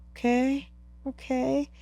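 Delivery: soft, high-pitched, tentative; tentative acceptance. Retold or imitated. Climber seeing sun come out, tentative acceptance. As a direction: agree gingerly, voice small and high. Retold calming once sun came out.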